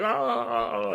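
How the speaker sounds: high hammed-up yelp of pain; mock pained cry. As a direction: fake a pained "ah" like a diver. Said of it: "he's like".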